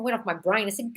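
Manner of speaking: ordinary delivery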